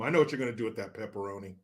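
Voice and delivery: hurried knowing mutter; quick mutter. Real, not acted. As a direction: rattle it off under the breath. Fast low aside.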